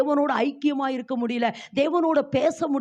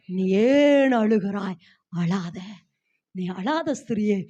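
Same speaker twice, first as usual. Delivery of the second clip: low, slow and soft; soft comfort; 5 semitones lower, much slower than usual. Acted. Jesus tenderly quieting the crying woman, low and soft. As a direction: hush her gently. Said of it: "ஏசப்பா சொல்றாரு".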